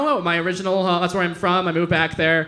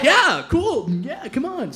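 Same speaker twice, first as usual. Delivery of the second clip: lightly mocked, high easygoing agreement; clueless agreeable Westerner; 8 semitones higher, slower than usual. Polite Westerner who doesn't know what's going on, mock agreeable. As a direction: play along without knowing what's going on.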